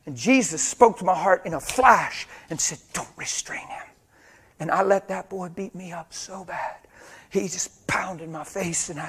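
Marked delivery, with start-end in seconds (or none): 2.9-3.9 whispered instruction; acted urgent whisper; hushed command. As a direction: whisper it like an inner prompting. Retold inner voice of Jesus.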